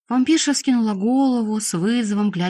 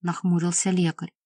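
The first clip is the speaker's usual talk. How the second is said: ordinary delivery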